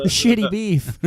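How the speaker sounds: high, laughing cartoon kid voice; high silly cartoon voice through laughter; mock Cartman voice. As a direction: squeal it like a bratty cartoon kid. Mocking the South Park line.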